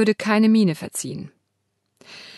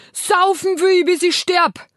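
high, loud bellowed order; bellowing; 12 semitones higher, 5 dB louder, slower than usual. Acted. Martin bellows at his wife for schnapps, loud. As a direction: roar it at your wife.

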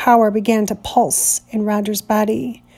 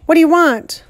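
challenging; 6 semitones higher, 3 dB louder than usual. Acted. Sudden challenge.

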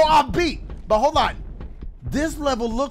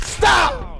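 sharp shout; 7 semitones higher, 4 dB louder than usual. Real, not acted. Shouting at the game.

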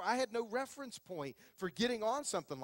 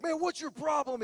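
annoyed cousin; 7 semitones higher, 6 dB louder than usual. Retold or imitated. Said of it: "he's like".

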